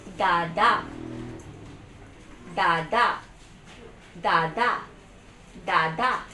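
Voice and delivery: loud, two even beats with a lift at the end; modelling syllables. Shown as a demonstration. Therapist repeating a word for child, loud and rising. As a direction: punch out both syllables for a learner.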